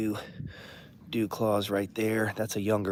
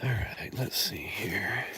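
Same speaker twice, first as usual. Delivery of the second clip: whispered; 5 dB quieter, slower than usual. Real, not acted. Whispers while searching.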